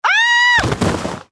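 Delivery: cartoonish scream of falling; high falling scream. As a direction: yelp as if you're plunging out of a window. Falls face first in dirt.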